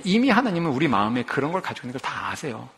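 usual speaking voice

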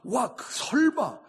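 high, loud cries of amazement; astonishment. Shown as a demonstration. Preacher acts out what Behold means, astonishment. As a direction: exclaim as if you can't believe it. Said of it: "이게 무슨 뜻이냐면".

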